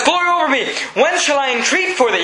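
high, eager exclamation then question; thrilled and ready to pray; excited Moses. Acted. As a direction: Moses eager to ask Pharaoh when. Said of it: "he's excited".

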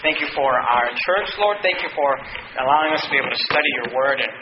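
low, soft pastoral prayer. Real, not acted. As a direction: pray quietly over the congregation. Closing prayer.

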